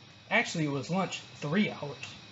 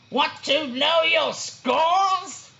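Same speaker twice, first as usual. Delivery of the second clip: much higher, loud, slow bellow; wound-up boar shout; 10 semitones higher, 5 dB louder, much slower than usual. A narrator's reading. Agitated boar confronting the sloths, shouting. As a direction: yell it at the waking class.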